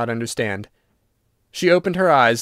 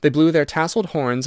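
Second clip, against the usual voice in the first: plain reading voice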